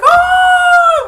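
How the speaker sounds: very high, long loud scream; drawn-out mock shriek; imitated scream. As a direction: mimic a terrified villain screaming.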